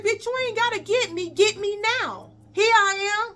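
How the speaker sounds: very high, defiant taunting; taunting dare. Retold or imitated. Student daring the teacher to fight, very high taunt. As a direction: goad her to come now. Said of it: "is what I told her".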